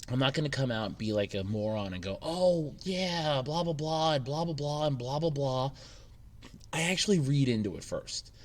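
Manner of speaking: ordinary talk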